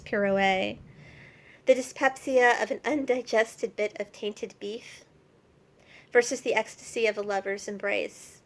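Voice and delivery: deliberate, emphatic poem reading; poem recitation. Acted. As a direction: declaim it in measured phrases, stressing key words. Reads her apology poem.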